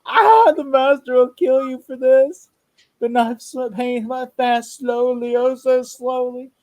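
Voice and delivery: high, loud, slow put-on vampire voice; Evil Ed voice. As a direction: drag out the threat like a hammy monster. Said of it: "He goes back".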